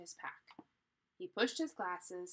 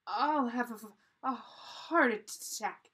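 loud, slow, shuddering stammer; shivering; 4 semitones higher, 8 dB louder, slower than usual. A narrator's reading. Jack fears the freezing water, shivering. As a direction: stammer it out through the cold.

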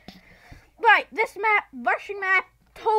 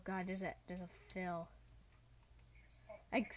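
ordinary talk, nothing marked

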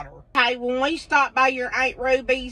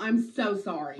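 normal talking voice

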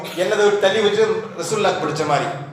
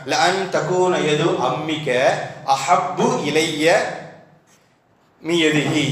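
slow Arabic recitation; 3 semitones lower, much slower than usual. Shown as a demonstration. Arabic hadith quoted.

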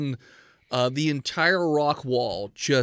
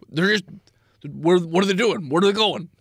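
alarmed dwarf; 3 semitones higher, 7 dB louder than usual. Acted. Dwarf questioning party.

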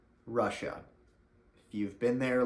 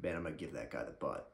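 much softer, lower, fast mumbled threat; a feeble schoolyard boast; weak kid bluffing to a bully, soft and quick; limp threat; 8 semitones lower, 9 dB quieter, faster than usual. Retold or imitated. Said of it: "you were like".

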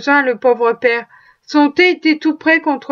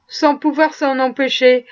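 ordinary delivery

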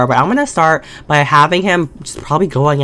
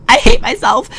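much higher, louder "I hate myself"; dramatic wail; 4 dB louder than usual. Shown as a demonstration. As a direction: wail "hate" in a high squeal. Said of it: "why did I do that".

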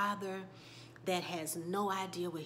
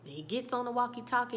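ordinary talk

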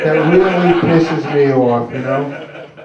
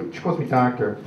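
usual speaking voice

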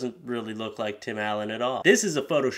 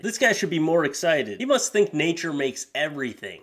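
usual speaking voice

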